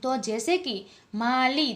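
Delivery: ordinary delivery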